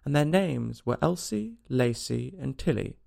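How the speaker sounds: ordinary delivery, nothing marked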